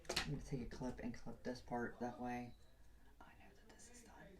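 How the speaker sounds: whispered self-talk; hushed aside to yourself. Real, not acted. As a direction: whisper it while concentrating. Aside while working on hair.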